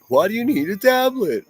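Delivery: very high, loud squeaky imitation; mock a puberty boy whose voice breaks; voice-cracking teen. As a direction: streamer mocking a guest's whiny question as a squeaky teen. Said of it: "His voice cracks and everything".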